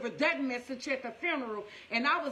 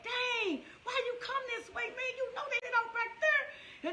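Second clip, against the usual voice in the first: high, exasperated self-scolding; frustrated with myself; 12 semitones higher than usual. Retold or imitated. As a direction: scold yourself for the wrong turn. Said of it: "I'm just frustrated with myself".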